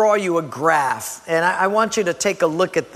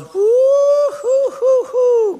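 long, high-pitched "woo, hoo, hoo"; excited whooping; 5 dB louder, much slower than usual. Acted. New believer whose excitement soars, whooping high. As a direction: whoop in loud, giddy mock delight. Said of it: "your excitement goes".

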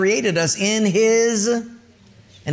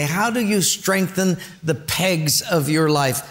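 ordinary talk

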